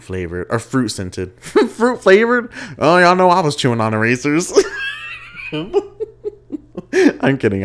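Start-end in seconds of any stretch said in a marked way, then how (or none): 1.4-2.5 high, loud and slow, laughing at the slip; laughing surprise. Real, not acted. Catching own slip, laughing. 2.8-7.6 loud, high, laughing shout. Real, not acted. Joking confession, shouting with laughter.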